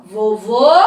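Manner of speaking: vovô ending on a closed ô. Shown as a demonstration. Teacher showing children the difference: closed ô. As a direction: say vovô with a tight, closed last ô. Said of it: "Olha só a diferença".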